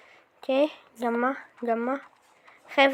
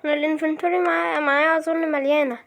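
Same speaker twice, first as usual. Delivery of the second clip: ordinary talk, nothing marked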